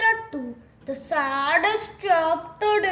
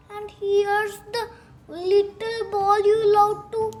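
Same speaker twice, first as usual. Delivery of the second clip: usual speaking voice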